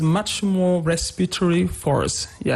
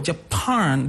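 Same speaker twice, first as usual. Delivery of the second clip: "Japan" stressed on PAN; second-syllable stress. Shown as a demonstration. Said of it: "second syllable".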